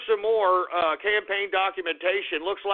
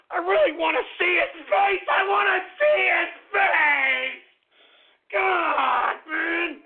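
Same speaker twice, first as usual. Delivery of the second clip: furious shout; 10 semitones higher, slower than usual. Acted. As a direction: scream it out in rage. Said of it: "I gotta calm down".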